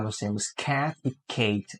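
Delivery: "cat" short, "Kate" long "ei", soft and slow. Shown as a demonstration. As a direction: contrast the two vowels. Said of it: "Olha a diferença". (contraste entre cat e Kate)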